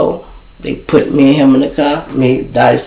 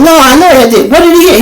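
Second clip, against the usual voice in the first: high-pitched, louder pressing question; acted-out exasperated commander; retold insistent demand; 13 semitones higher, 5 dB louder, faster than usual. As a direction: press impatiently for an answer, voice way up.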